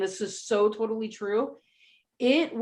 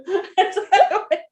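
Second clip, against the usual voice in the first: high loud laughter; 9 semitones higher, 6 dB louder than usual. Real, not acted. Laughing at her own comeback, talking to Tina. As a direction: crack up again. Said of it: "laughs at own joke".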